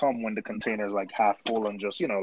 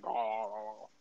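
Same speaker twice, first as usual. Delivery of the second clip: mock Chatterton accent; 12 dB quieter than usual. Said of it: "If you're from Chatterton, you sound like".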